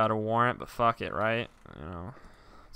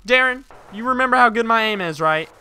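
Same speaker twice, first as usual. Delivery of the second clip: louder, higher taunting; mock threat; 7 semitones higher, 11 dB louder than usual. A hint of cop taunting partner Darren. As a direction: taunt him about your aim.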